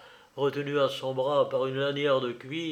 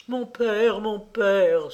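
higher-pitched plea, lightly tearful; mild, acted weeping call to a father; tearful cry; 6 semitones higher, 4 dB louder, slower than usual. A narrator's reading. Daughter running to her ruined father, tearful cry. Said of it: "s'écria-t-elle en pleurant".

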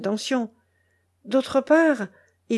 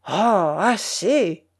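exasperated; much slower than usual. A narrator's reading. Exasperated bully cuts off the whining.

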